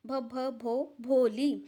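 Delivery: slow stammered name, repeating the first sound; stammering. Acted. Girl finally saying her name, slow stammer. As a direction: stumble then land on Bholi. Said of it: "At last she was able to say it".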